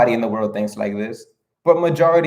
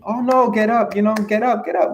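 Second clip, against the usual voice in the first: put-on much higher, cooing encouragement to a toddler; parent coaxing baby; 10 semitones higher than usual. Parent urging a fallen baby to stand, high cooing encouragement. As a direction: lift the voice way up like cheering on a baby.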